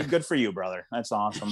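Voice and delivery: very low, subdued voice of approval; placating. Acted. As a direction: drop way down to humor a dangerous man. Acted wary reply to drug lord.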